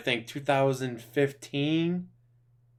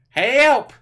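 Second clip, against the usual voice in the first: mock cry for help; 11 semitones higher, 10 dB louder than usual. Real, not acted. Laughing cry while reacting.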